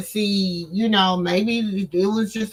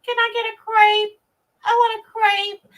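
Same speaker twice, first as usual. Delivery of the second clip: put-on much higher whiny little-girl voice, slowed; mocking a needy girl; 11 semitones higher than usual. Mocking the girlfriend begging again, high whiny child voice. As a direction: whine for the crepe like a spoiled child. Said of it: "She would ask for a crepe one more time".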